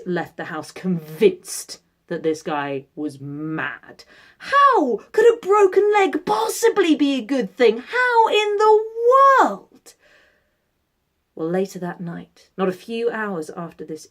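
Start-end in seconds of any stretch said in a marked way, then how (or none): 4.4-10.0 high, loud disbelief; incredulous villagers. Acted. Neighbours think the man mad.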